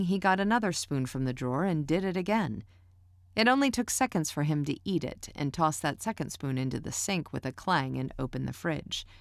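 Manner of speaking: ordinary delivery, nothing marked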